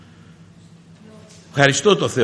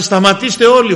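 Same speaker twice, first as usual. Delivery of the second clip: high, louder call to stop; shouting; 6 semitones higher, 5 dB louder than usual. Retold or imitated. Boss calls the office to stop, shouting. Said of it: "Φωνάζει".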